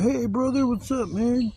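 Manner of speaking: high, sing-song "hey buddy" voice; hammed-up chummy greeting to a stranger; mock friendly greeting. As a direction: play it up as a fake-friendly hello. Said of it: "So you're like".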